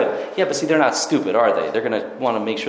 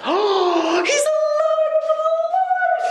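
high shrieking cry of joy, he's alive; fake joyful cry. Girl at the coffin cries out to the guards, shrieking with joy. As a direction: shriek out fake relief, far higher.

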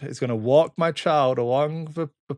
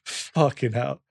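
usual speaking voice

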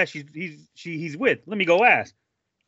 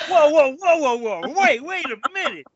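high, stunned stopping her friend; whoa, hold everything, disbelief; shocked whoa; 9 semitones higher, 5 dB louder than usual. Real, not acted. Friend cuts in, stunned by the guy's line.

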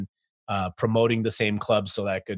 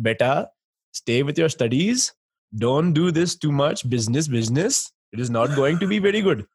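put-on Indian parent accent; 4 semitones higher, 3 dB louder than usual. Lightly accented father telling his son to stick with studies. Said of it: "a minor attempt at an accent".

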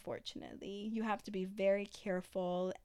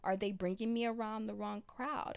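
normal talking voice

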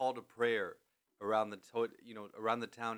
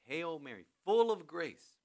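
slow, raised prayer voice, louder on full of grace; prayerful recitation; 5 semitones higher, 8 dB louder, slower than usual. Shown as a demonstration. Reciting the start of the Hail Mary to Izzy, prayerful. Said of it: "that's the very beginning of the Hail Mary".

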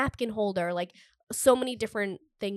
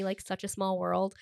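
ordinary talk, nothing marked

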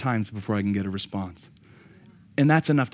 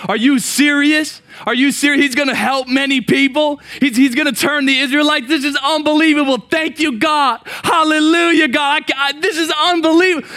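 much higher and louder, exclaiming in delight; overjoyed disbelief; 6 dB louder than usual. Acted. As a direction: burst out like you can't believe the news. Preacher acting out overjoyed reaction.

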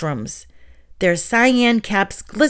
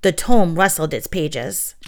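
ordinary delivery

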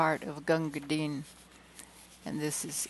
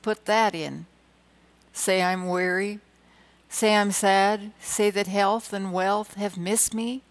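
rhythmic verse reading, swelling toward the end; poem recitation; 3 semitones higher, 5 dB louder than usual. Acted. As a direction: recite it with a building lift. Recites Leigh Hunt poem.

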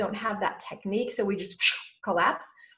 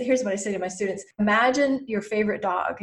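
usual speaking voice

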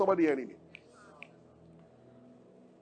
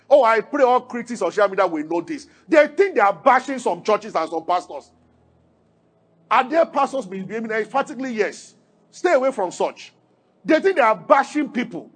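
normal talking voice

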